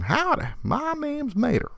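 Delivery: very high, slow Southern twang; Mater voice. Retold or imitated. Father reading to son, hammed-up Mater voice. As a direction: ham up Mater for the kid. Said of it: "Larry the Cable Guy impression".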